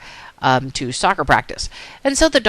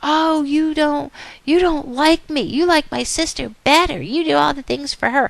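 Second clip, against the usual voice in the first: put-on high, whiny child's protest; acted aggrieved daughter, pitched way up; complaining little girl; 10 semitones higher than usual. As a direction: play a kid wailing about favouritism. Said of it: "the girl starts saying things like".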